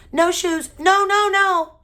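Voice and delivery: high, loud tantrum scream; screaming toddler. A narrator's reading. Toddler in a tantrum at the shoe salesman, screaming. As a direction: shriek it like a kicking little kid. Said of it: "He screamed".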